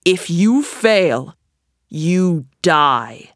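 slow, measured threat; storming. A narrator's reading. Sultan threatens Aladdin, storming. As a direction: deliver the threat word by word, dropping at the end. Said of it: "he stormed".

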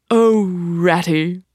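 lightly voiced lovesick Toad; blissful sigh. A narrator's reading. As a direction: swoon over the thought.

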